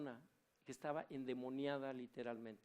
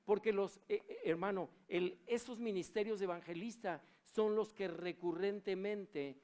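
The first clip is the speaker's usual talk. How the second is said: fiery preaching; 5 semitones higher, 7 dB louder than usual. Acted. Preaches at full force.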